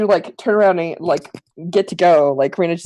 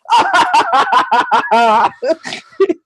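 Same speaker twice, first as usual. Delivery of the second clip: laughing; 7 semitones higher than usual. Real, not acted. Laughs at own line.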